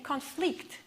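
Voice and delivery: "conflict" as a verb, con-FLICT; reduced con, stressed FLICT in "conflict"; stress on last syllable. Shown as a demonstration. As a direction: lean on the end of "conflict". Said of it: "the last syllable is stressed".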